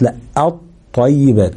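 الطيبات slow, the ط doubled and firm; الطيبات بطاء مشددة واضحة; correct doubled ta. Shown as a demonstration. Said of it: "بقت طاء مشددة".

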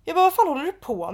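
retold angry confrontation. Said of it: "då blir jag förbannad".

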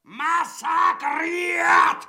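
drawn-out shouted threat; furious drawn-out shout. Acted. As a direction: roar the word out slowly, high and loud. Tyrant repeats threat to peasant.

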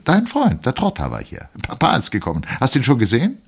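high, loud chirping woman's voice; wife chirps at her husband, high and loud. A narrator's reading. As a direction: chirp it out brightly like a bird. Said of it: "mit zwitschernder Stimme".

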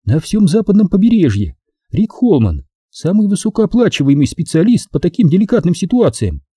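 high-pitched woman putting him in his place; sugary rebuke in a high female voice; Maxine, sweetly cutting. Acted.

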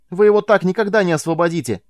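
high, loud, raging yell; furious shout. A narrator's reading. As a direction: scream it in fury. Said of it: "яростно закричала Беатрис".

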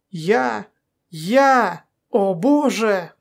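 halting, drawn-out disbelief; overwhelmed exhalation. A narrator's reading. Feldman hears the patient speak, overwhelmed. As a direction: stretch each word out in awe. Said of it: "выдохнул Фельдман".

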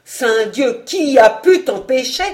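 angry oath rising to a loud question; furious demand. A narrator's reading. Vaunois shakes old Alain, furious demand. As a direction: demand it, exasperated.

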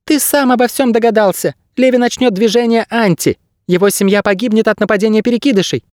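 high, thin bird voice; squeaky bird guide. A narrator's reading. Bird guide revealing the coming attack, high squeak. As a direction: foretell it in a tiny squeak. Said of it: "сказал Птах".

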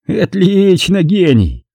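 a mild sneering grin in the voice; smirking. A narrator's reading. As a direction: scoff at him with a smirk. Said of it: "усмехнулся он".

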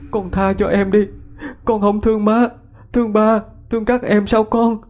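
high-pitched crying plea; tearful pleading. A narrator's reading. Mother begs her dead child to spare the younger one, weeping. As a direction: beg desperately through tears. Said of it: "Bà Sáu khóc lóc".

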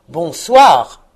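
loud, falling goodnight; curt dismissal. A narrator's reading. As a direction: shut him out with one forceful word. Scrooge congédie encore son neveu. (congédiement sec et fort)

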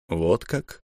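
low, soft, drawn-out reply; a slow, detached remark; said slowly. Acted. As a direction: let the words come slowly and coolly. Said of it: "медленно проговорил государь".

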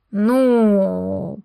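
a long, lazy stretched well; slow drawled hesitation; drawn-out well. A narrator's reading. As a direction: stretch it out, taking his time. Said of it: "протянул Шантар".

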